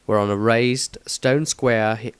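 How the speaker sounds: ordinary delivery, nothing marked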